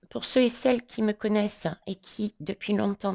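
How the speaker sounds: usual speaking voice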